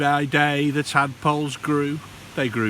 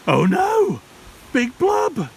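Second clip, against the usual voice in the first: alarmed; 11 semitones higher, 6 dB louder than usual. A narrator's reading. Narrator alarmed at the fish.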